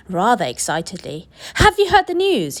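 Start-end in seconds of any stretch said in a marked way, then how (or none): 1.6-2.6 high, loud eager announcement; father bursting into the room crying out, high and loud; excited cry. Acted. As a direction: burst in with the news. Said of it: "he cried".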